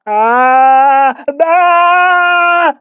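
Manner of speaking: high, loud, drawn-out wordless moan; long moaning cry.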